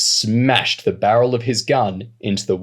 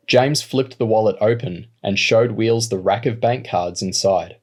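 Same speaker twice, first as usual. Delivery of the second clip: plain reading voice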